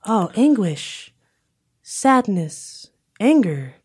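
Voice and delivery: high, drawn-out empathic reading; Mantis impression. Empath sensing a stranger's feelings, high and drawn out.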